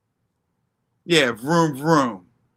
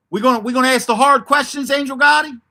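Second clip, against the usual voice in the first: calling out; 7 semitones higher, 5 dB louder than usual. Real, not acted. Calling out to the community.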